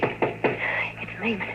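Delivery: urgent hushed whisper; frightened whisper at the door. Acted. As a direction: breathe the warning, slowly. Warning whisper as Lehman arrives.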